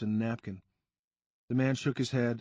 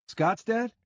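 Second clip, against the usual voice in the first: higher startled Weaver?; surprised question; 11 semitones higher, 4 dB louder than usual. A narrator's reading. Sean reacting to the narrator's name, higher surprised.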